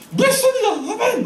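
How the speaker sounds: very high, loud laughing cry; shouted amen. Retold or imitated. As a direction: shout your faith, laughing at yourself. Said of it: "막 이러면서". (웃으며 높게 외치는 아멘)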